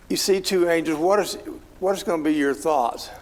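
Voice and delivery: ordinary delivery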